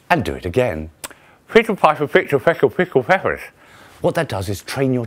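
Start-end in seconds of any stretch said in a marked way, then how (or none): 1.5-3.4 talking around a knuckle; knuckle between teeth. Shown as a demonstration. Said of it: "get a knuckle, put it between your teeth".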